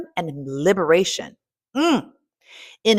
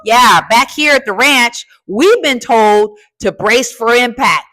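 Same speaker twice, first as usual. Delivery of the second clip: mock warning; 4 semitones higher, 8 dB louder than usual. Mock alarm at the ranch.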